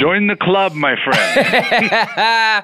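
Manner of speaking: high, laughing tease to the host. Real, not acted. Guest laughing at the host who hasn't read the book.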